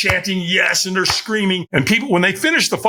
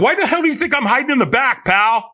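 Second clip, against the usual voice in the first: higher, mock exasperated jab; exasperated retort; 7 semitones higher than usual. She snaps back at the stranger, mock exasperated. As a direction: throw his question back at him, annoyed.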